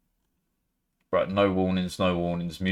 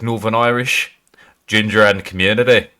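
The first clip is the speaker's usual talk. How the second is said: quick Northern Irish impression; Northern Irish accent; 4 dB louder than usual. Shown as a demonstration. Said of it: "Then you've got, like".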